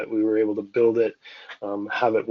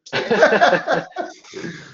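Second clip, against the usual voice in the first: a real, high laugh that falls away; 12 semitones higher, 5 dB louder than usual. Real, not acted. Enjoying his own joke about AI replacing him, high laugh. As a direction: let the laugh roll out and fade.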